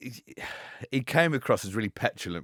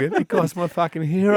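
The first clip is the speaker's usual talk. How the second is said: relieved laughing quote; 9 semitones higher, 7 dB louder than usual. Retold or imitated.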